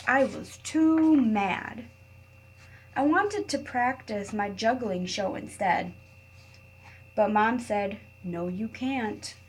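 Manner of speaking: put-on reading to children. A narrator's reading. Teacher reading a picture book to her class, expressive read-aloud. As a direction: read it like story time for little kids.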